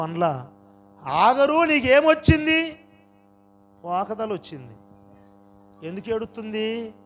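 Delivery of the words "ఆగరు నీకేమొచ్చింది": lightly played Lord, high and loud; voicing the Lord. The Lord calling to weeping Hagar, lightly voiced, raised. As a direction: call out the question, voice climbing. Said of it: "ప్రభు ఏమంటున్నాడంటే".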